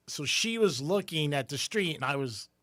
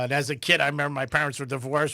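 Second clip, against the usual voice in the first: normal talking voice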